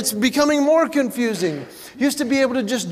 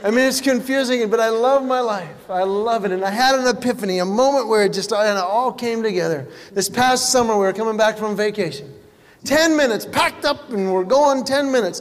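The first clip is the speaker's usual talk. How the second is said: ordinary delivery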